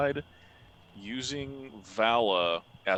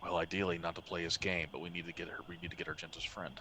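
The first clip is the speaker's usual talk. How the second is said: very soft, hushed scheming; barely raised, secretive voice; whispering; 3 semitones lower, 8 dB quieter than usual. Acted. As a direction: plot it under your breath. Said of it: "he's whispering as quietly as possible".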